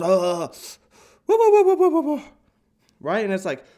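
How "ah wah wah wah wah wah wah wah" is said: shrill high yapping wahs; yappy chihuahua barks. Retold or imitated. Chihuahua yapping at a visitor, very high. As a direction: yap like a tiny dog, very high and loud. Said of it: "the Chihuahuas are just".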